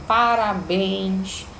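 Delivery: parabéns drawn out very slowly, BÉNS pressed hard; slow teaching demo, stress on BÉNS in parabéns; stress on the last syllable. Shown as a demonstration. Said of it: "veja como eu tenho uma intensidade na última sílaba".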